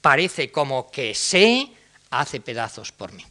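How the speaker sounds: mock-passionate recital; hammed-up verse. Shown as a demonstration. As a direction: overplay the lovesick lines. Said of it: "otra vez".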